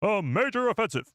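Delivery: high, dramatic newsreel announcer; Clone Wars narrator. Acted. Streamer copying the show's narrator, Clone Wars narrator impression. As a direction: proclaim it like a war bulletin. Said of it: "I'll do my impression".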